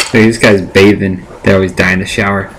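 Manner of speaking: usual speaking voice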